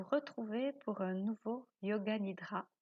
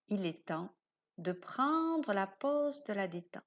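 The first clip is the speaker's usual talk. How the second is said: guided meditation. Acted. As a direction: lull the listener towards sleep. Guide une séance de yoga nidra. (voix de méditation guidée, lente et douce)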